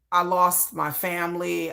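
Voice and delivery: normal talking voice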